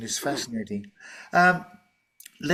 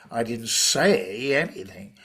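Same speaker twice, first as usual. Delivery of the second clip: slow answer with a stretched "say"; stress on SAY; 2 semitones lower than usual. Shown as a demonstration. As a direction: draw out "say", then let "anything" fall away. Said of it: "the word "say" is, of course, is a verb".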